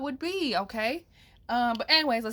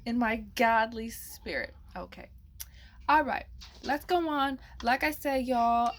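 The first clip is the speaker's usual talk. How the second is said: ordinary delivery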